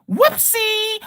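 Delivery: high, sing-song mock whoopsie. Preacher mocking a rival teacher's claim, squeaky mock whoopsie. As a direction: tease the opponent with a squeaky whoopsie.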